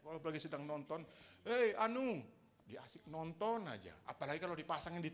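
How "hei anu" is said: loud, high call for attention. Retold or imitated. Calling someone glued to a drama, raised loud call. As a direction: shout a name across the room. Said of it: "kita teriak-teriak".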